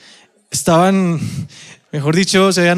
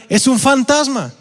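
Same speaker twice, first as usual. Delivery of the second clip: mock fright, higher and drawn out at the end; asustados, imitando; 8 semitones higher than usual. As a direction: act out frightened men seeing a ghost. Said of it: "llenos de miedo, dijeron". (asustados, exclamándolo en voz más aguda)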